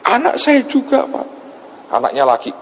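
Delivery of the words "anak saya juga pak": high-pitched mock wail; imitated tearful plea. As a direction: imitate a crying mother adding to her plea. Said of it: "Dia nangis". (menirukan ibu menangis, suara tinggi)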